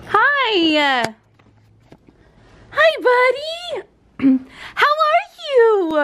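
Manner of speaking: doting pet talk. Real, not acted. Owner greeting dog after a day apart, doting baby talk.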